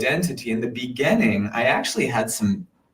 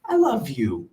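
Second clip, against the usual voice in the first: higher self voice; 12 semitones higher than usual. Retold or imitated. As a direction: gently comfort your anxious little self, pitched up and hushed. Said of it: "I came in as my higher self and I said".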